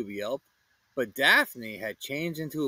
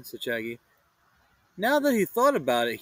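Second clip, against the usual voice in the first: usual speaking voice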